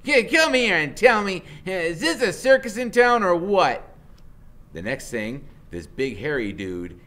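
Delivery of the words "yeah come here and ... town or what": higher, louder mocking call; sneering woman. Woman mocking the kids to her man, loud and sneering. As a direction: jeer at the strange kids. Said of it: "she says out of the side of her mouth".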